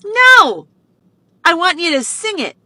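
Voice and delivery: put-on high, loud child voice insisting; a small child correcting you, high and loud; demanding little girl. Birthday girl insisting the stranger sing, high loud child voice. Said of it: "she goes".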